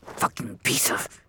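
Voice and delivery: low unvoiced grumble under the breath; muttering to himself. A narrator's reading. Man tinkering with an engine, muttering to himself. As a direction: curse quietly to yourself. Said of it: "muttering to himself as he tinkered".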